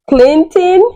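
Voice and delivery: high, loud shocked yell of a name; an outraged scream, replayed for the story; retold scream. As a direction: shriek the name in disbelief. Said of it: "I screamed".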